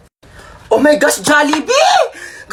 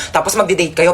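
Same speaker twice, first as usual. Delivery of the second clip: ordinary delivery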